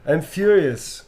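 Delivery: a fake European accent, fuming for laughs; mock European accent fury. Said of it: "a tour of Europe with our accent".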